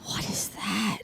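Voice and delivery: breathy whispered question. Retold or imitated. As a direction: whisper it as if woken. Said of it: "I love she".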